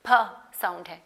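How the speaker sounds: ordinary delivery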